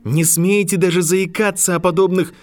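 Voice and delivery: high, loud furious outburst; rising rage. A narrator's reading. Harry furious at McGonagall, high loud rage. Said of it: "гнев Гарри всё больше выходил из-под контроля".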